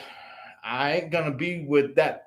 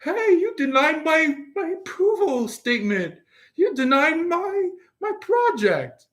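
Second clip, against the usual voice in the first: much higher, complaining faker voice; whiny fakers; 12 semitones higher than usual. Fakers protesting to Aquarius, high and whiny. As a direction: whine it like an indignant cheat. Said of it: "they're like".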